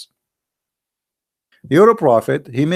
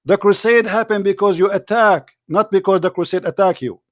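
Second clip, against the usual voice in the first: angry yelling; 6 semitones higher than usual. Real, not acted. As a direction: shout her down with rage. Shouting insults at caller.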